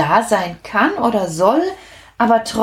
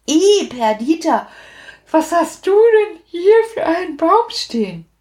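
a touch of disgust, higher; 9 semitones higher than usual. A narrator's reading. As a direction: wrinkle your nose at her tree. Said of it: "sag ich". (angeekelt, leicht höher)

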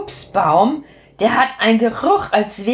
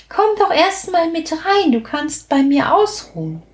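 higher little witch inviting; kind witch; 8 semitones higher than usual. A narrator's reading. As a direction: witch coaxing the tired guest inside. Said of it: "Das bemerkt die kluge Perdita und sagt". (kleine Hexe, einladend)

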